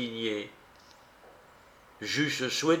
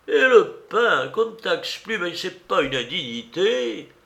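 indignant gossip; 6 semitones higher, 3 dB louder than usual. Acted. Gossiping women complaining.

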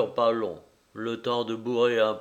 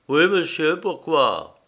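coachman voice; 4 semitones higher, 6 dB louder than usual. Acted. Coachman answers.

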